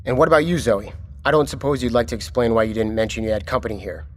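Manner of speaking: usual speaking voice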